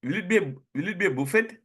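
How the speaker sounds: buffet with the T, boo-fet, asking; lightly voiced younger self; imitating own old buffet. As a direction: replay the wrong buffet at the party. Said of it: "I asked".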